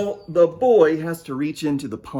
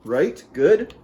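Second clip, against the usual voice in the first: ordinary delivery, nothing marked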